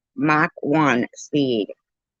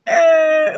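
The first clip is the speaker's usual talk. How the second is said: high, loud tire squeal; drawn-out high "errr" screech; imitated screech; 11 semitones higher, 4 dB louder than usual. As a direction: squeal like wheels rounding a corner. Imitates wheels screeching round corner.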